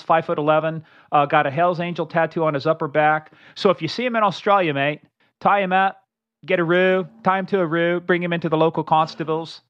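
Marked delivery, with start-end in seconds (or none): 3.5-9.6 mock Aussie accent, hammed-up; bad Australian accent. Host telling listeners to catch the fugitive, mock Australian accent. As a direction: badly fake an Australian accent. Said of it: "That's my bad Australian accent".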